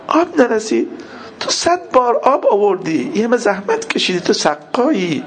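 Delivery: high, grieving plea; tearful lament. Retold or imitated. Voicing Imam Hussein to Abbas about the water, tearful lament. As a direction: plead tearfully, pitch lifted.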